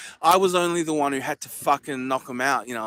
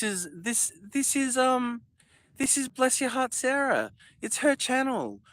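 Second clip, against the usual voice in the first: high put-on voice, a little softer; 8 semitones higher, 4 dB quieter than usual. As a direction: pitch up into a borrowed voice. Voicing a different channel.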